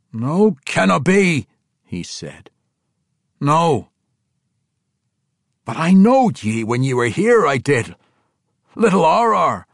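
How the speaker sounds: a dwarf king refusing to believe, high and loud. Acted. King Emrys recalling young Arar, stunned dwarf voice. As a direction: push back in a rough dwarf brogue.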